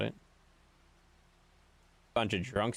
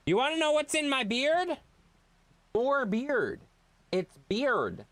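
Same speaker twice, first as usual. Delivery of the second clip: mock outburst; 12 semitones higher than usual. Real, not acted. Loud mock outburst about beard.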